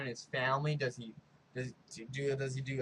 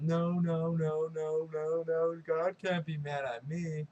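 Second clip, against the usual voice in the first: higher-pitched, mildly frantic denial; mock freak-out; 3 semitones higher than usual. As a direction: imitate a mother in denial, repeating it in rising distress. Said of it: "the mom's freaking out".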